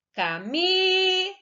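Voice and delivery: "Camila" called high with MI held long; high singsong call of "Camila", long drawn MI. Shown as a demonstration. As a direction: call "Camila" as if to a friend at the door, stretching MI. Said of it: "Nós vamos chamar as palavras".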